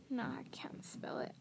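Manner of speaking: whispered, sheepish reply; hushed admission. A narrator's reading. Hagrid confessing to Harry in a whisper. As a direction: breathe it out ashamed.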